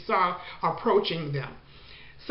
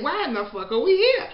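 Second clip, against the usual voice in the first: a higher, slightly louder replay of the swear; mock exasperation; 5 semitones higher, 3 dB louder than usual. Teller replaying the host's line, mildly exasperated. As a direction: repeat the outburst for the listener, still fed up. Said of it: "that's what he said".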